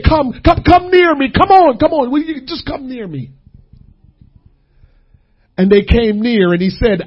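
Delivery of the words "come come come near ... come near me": higher, urging come on; pleading call. Acted. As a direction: beg them to come near. God calls lovingly.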